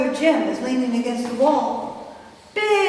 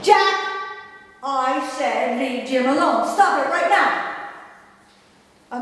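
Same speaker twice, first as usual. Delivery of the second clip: sharp call of the name, then slow insistence; angry command; much slower than usual. Retold or imitated. Sister refusing to stay out of brothers' fight, angry. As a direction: demand it stop, punching the name.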